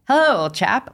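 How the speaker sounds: put-on light transatlantic accent, old chap; mock posh transatlantic greeting. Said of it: "talked with a transatlantic accent".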